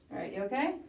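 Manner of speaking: ordinary talk, nothing marked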